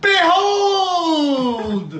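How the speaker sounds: booming villain cry of triumph; grand proclamation. Acted. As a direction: proclaim it like a dark sorcerer.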